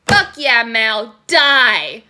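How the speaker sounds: loud triumphant shout; gleeful cheer. Retold or imitated. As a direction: cheer his death like a fan in the stands. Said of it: "I was like".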